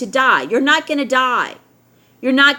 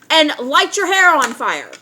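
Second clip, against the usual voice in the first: normal talking voice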